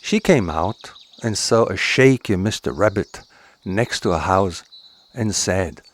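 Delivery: ordinary delivery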